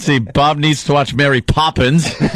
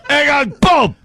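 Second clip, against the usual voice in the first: put-on high English-accented holler; mock English send-off; 10 semitones higher than usual. Co-host seeing the intern off in a high mock-English shout. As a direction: cry it out like a cartoon Englishman, accent thick.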